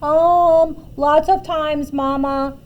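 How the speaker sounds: loud, sheepish giant; a hint of big-baby whine; whimpering. A narrator's reading. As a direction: whimper like a scolded child. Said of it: "whimpered the giant".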